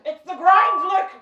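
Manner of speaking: high, loud overwrought wail; despairing cat. Pretend. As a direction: wail like a burnt-out hustler cat.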